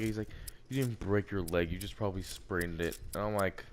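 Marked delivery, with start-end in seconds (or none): 0.7-3.0 lightly voiced dismissive guy, lower and softer; low-key, brush-off impression of a jerk. A jerk at the Y doubting a broken leg, mildly dismissive. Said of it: "one guy who was a complete ass".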